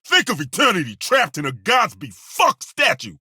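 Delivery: usual speaking voice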